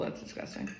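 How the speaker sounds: normal talking voice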